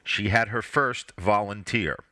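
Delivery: ordinary delivery, nothing marked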